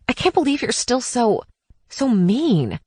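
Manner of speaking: hurt, barely holding it together; a hint of trembling hurt; holding back tears. A narrator's reading. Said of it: "fought to keep her voice from trembling".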